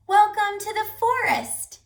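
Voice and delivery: high loud friendly shout. Acted. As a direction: call out a welcome. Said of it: "the animals shouted".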